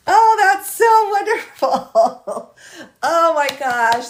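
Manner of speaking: high, loud, drawn-out gush with laughter; tearful delight. Real, not acted. Streamer thanking viewer for the pants, tearful delight. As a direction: laugh through it, overcome and near tears. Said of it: "I'm trying not to cry".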